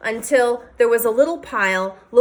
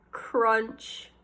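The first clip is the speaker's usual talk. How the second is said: hushed; 7 dB quieter than usual. A narrator's reading. Sound of a beetle bite.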